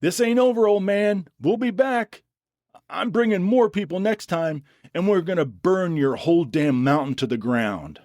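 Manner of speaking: high screamed threats; screaming threats. A narrator's reading. Dylan screams threats at Jack while retreating. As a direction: hurl the threats back over your shoulder. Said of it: "still screaming threats the entire way".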